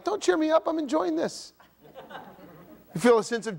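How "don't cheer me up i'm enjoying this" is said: high mock-grumpy whine; pitched-up sulky grumbling. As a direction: whine like a sulking grump who likes it. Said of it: "I'm like".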